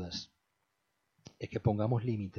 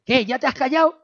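mother's voice; 13 semitones higher, 9 dB louder, faster than usual. Retold or imitated. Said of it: "mi mamá dice".

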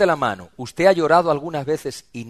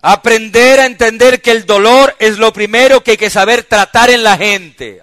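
full-force preaching; 9 semitones higher, 15 dB louder than usual. Real, not acted. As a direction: proclaim each point from the pulpit.

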